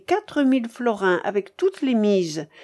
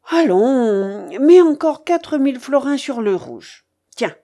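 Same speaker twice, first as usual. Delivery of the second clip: loud, high, bossy orders; imperious old gambler; 4 semitones higher, 7 dB louder than usual. Acted. Babouchka orders her companion to bet, imperious. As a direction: silence him and order the bet.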